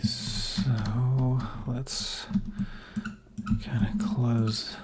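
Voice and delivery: quiet whispered building commentary; soft whisper. Acted. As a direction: whisper along as you place blocks. Whispers while building in game.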